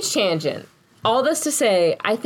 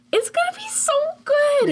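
excited. Retold or imitated. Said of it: "I'm just like".

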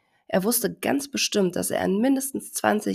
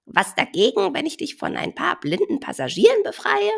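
chirpy bird; 11 semitones higher, 5 dB louder than usual. Acted. Bird offers to clean elephant.

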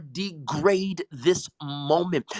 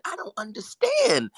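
ordinary talk, nothing marked

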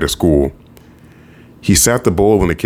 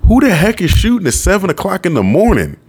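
annoyed outburst; 13 semitones higher than usual. Acted. Annoyed character wakes to gunshots.

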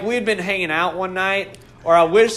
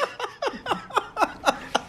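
high laugh; 8 dB quieter than usual. Real, not acted. Laughing at own story.

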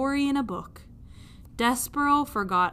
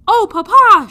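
much higher and loud; excited call; 11 semitones higher, 18 dB louder than usual. Acted. Princess spotting the mouse, calls her father excitedly. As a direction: cry out to Papa in delight. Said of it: "she said".

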